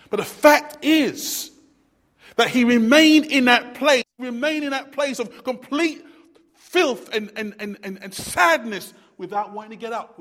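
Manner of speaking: loud, rising sermon delivery; fiery preaching. Acted. Preacher driving his point home to the church, loud and fervent. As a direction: preach it hard to the congregation. Preacher building to full force.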